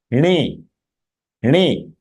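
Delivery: "ne" with curled-tongue n and long vowel; retroflex n. Shown as a demonstration. Teacher drilling the syllable, "ne" as retroflex "ney". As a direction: curl the tongue back for "ne". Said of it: "So this is".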